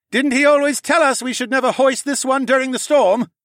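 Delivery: high, loud shouted question; worried question. A narrator's reading. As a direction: ask urgently over the storm. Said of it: "Horace asked".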